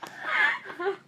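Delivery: squealing burst of laughter; high shrieking laugh. Real, not acted.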